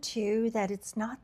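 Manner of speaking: ordinary talk, nothing marked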